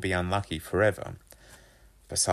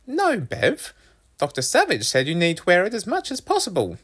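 high, louder mother voice; firm mum; 12 semitones higher, 4 dB louder than usual. A narrator's reading. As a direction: refuse your child firmly, pitched well up. Narrator plays firm mum.

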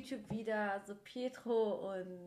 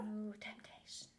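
slow, breathy dramatic whisper; 8 dB quieter, slower than usual. Pretend. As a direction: hush it like a secret. Dramatic whisper of show title.

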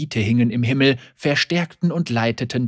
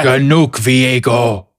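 stern command; 9 dB louder, slower than usual. Acted. Priest interrupts the heretic.

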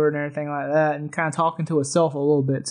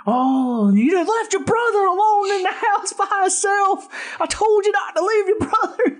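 much higher, put-on nagging scold; scolding cook; 14 semitones higher than usual. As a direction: tear into him like the cook.

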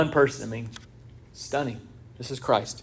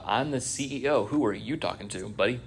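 mock arrogant put-down; 3 semitones lower, 8 dB quieter than usual. Imitating arrogant self.

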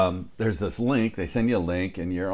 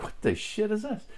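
shocked disbelief; 3 dB quieter than usual. Retold or imitated. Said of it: "I'm like".

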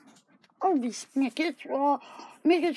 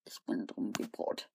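whisper; 12 dB quieter than usual. Acted. Whispered self-insult joke.

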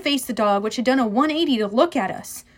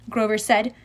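ordinary delivery, nothing marked

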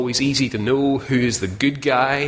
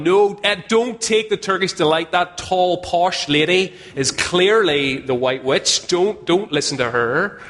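mock scream at the screen; 7 semitones higher than usual. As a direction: yell at Edmund on screen not to take it. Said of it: "you're screaming at him".

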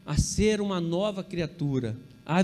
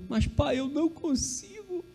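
put-on crying child; 8 semitones higher than usual. Daughter pleading with her father, tearful and high. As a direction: imitate a tearful five-year-old. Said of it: "falou assim, chorando".

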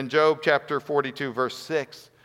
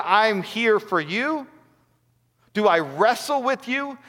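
impassioned preaching; 4 semitones higher than usual. Acted. Pastor urging listeners about Job, impassioned preaching. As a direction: drive the point home to the congregation.

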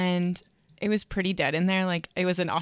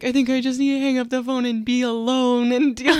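mock tearful; 5 semitones higher, 8 dB louder than usual. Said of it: "and then I'm like".

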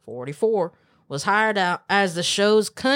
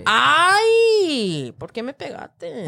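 surprised yelp; 10 semitones higher, 5 dB louder, much slower than usual. Real, not acted. Hit by friend.

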